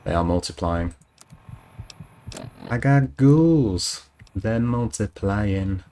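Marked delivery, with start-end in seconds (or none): 2.7-5.8 drawn-out mock alarm. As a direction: drag it out in mock horror.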